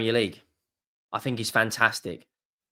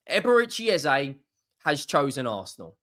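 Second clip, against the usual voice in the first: ordinary talk, nothing marked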